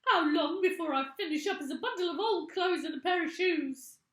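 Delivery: put-on very high, loud pleading old woman; desperate cry. A narrator's reading. Mrs Twit begging her husband, high and loud. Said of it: "cried Missus Twit".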